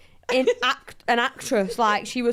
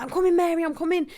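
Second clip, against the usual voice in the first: raised, mildly panicky calling; retold panic; 6 semitones higher, faster than usual. Telling podcast hosts of rushing to a teammate, mildly panicked. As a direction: call out in mock alarm as you rush. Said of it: "I'm panicking".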